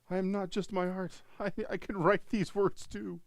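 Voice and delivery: high, loud pompous artist; mock artist voice. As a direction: play a proud artist who writes now. Mocking artists turned writers.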